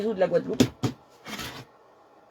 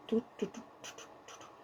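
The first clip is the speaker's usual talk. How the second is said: fading mutter; 20 dB quieter, faster than usual. Real, not acted. Fading mutter while searching.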